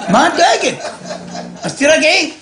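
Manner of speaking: high, amused, laughing through it; laughing reassurance. Retold or imitated. Husband laughing at his scared wife, telling her to calm down.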